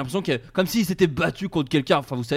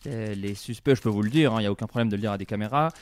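judge on television; 6 semitones lower than usual. Acted.

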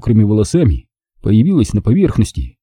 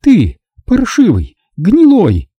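high, louder, slow spat insults; spitting words in rage; 11 semitones higher, 4 dB louder, slower than usual. A narrator's reading. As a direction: spit each word out, choking with fury. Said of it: "задыхаясь от злобы, выплёвывала она слова".

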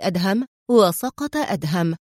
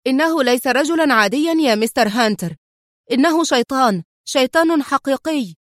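higher, agitated exclamation; crying out; 4 semitones higher, 4 dB louder than usual. A narrator's reading. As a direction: insist urgently, pitch lifted. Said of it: "هتف الطيار". (هتاف منفعل بنبرة مرتفعة)

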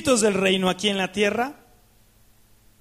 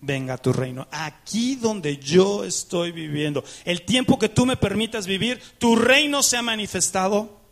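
prayer. Acted. Preacher prays the Lord's Prayer aloud.

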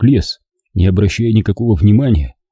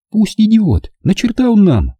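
higher, softened plea; moved; 12 semitones higher than usual. A narrator's reading. Anya pities the old man, mildly moved. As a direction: let pity win. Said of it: "расчувствовалась Аня".

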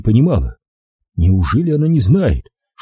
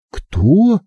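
high startled query; wary, high-pitched question; captive woman; 9 semitones higher than usual. A narrator's reading. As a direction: ask back in a raised woman's voice. Captive woman asks back.

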